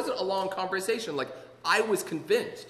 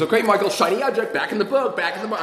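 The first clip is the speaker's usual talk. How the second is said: brisk redirection; 5 semitones higher, 7 dB louder than usual. Retold or imitated. Sponsor steering him back to reading.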